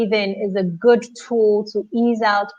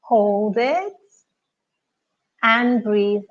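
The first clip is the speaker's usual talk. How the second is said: guided meditation; 2 semitones higher than usual. Acted. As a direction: guide the breath calmly, unhurried. Leads deep breathing in silence.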